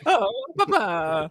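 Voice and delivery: high, drawn-out cartoon plumber voice; Charles Martinet as Mario. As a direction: stretch it out in a high mascot voice. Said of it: "He goes".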